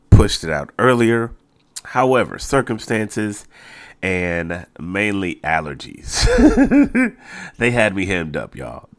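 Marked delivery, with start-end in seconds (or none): 6.3-7.5 laugh. Real, not acted. Laughing about his allergies.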